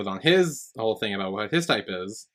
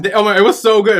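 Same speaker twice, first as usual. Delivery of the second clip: gushing; 8 semitones higher, 9 dB louder, faster than usual. Real, not acted. Gushing about the show parties.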